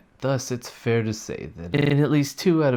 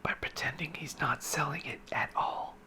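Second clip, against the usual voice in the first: secretive whisper; 5 semitones higher, 10 dB quieter than usual. Acted. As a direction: let them in on a secret.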